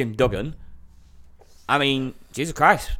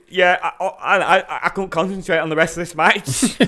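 usual speaking voice

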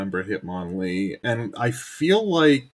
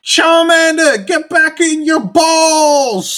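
much higher, much louder, slow mock British yell; shouting a trainer's order in a mock British voice; hammed-up British shout; 12 dB louder, much slower than usual. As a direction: bellow it like a pompous British villain. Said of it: "they hated British people in that show".

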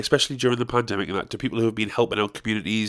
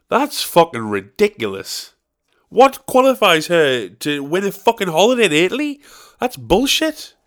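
indignant; 5 semitones higher, 3 dB louder than usual. Real, not acted. Indignant rant.

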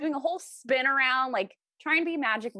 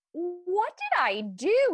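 baffled; 8 semitones higher, 4 dB louder, much slower than usual. Retold or imitated. Retold reaction to insult.

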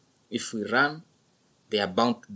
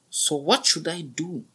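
put-on young buffalo voice; 8 semitones higher than usual. A narrator's reading. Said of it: "asked the young buffalo".

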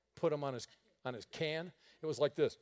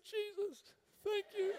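relieved tearful thanks; 7 dB quieter than usual. Retold or imitated. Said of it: "in like manner".

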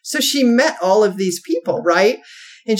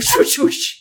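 swoosh sound; 7 semitones higher than usual. Retold or imitated. Imitating squirrel tail.

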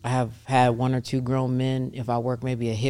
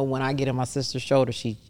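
normal talking voice

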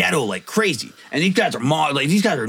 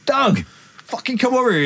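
much higher, pressing call to a friend; higher urgent retold call to Doug; 8 semitones higher than usual. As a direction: yank his attention across the room. Calling his friend over urgently.

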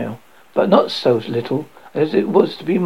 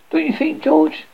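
coy question; 13 semitones higher than usual. A narrator's reading. Wife teasing husband fondly.

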